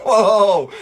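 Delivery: loud, high laughing whoa. Real, not acted. Chocolatier reacting to a viewer's cheeky chef comment, laughing loudly. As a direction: burst out in mock alarm.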